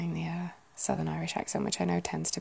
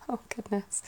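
ordinary delivery

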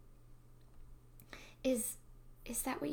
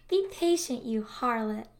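teasing scold; 7 dB louder than usual. Acted.

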